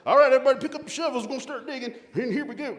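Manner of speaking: high, loud call to the neighbors to grab shovels; farmer calling neighbors. Acted. Farmer calls his neighbors over to dig, high and loud. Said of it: "That's how farmers talk".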